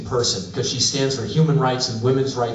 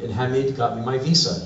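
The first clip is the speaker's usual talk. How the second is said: ordinary delivery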